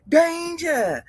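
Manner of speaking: high drawn-out warning call; mock warning. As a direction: sound the alarm, half joking. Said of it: "warning sing-song".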